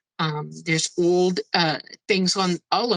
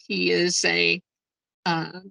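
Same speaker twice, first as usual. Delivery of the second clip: ordinary delivery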